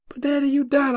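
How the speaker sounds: put-on high, pleading young boy; scared child. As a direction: beg your dad like a frightened kid. Child self voiced high.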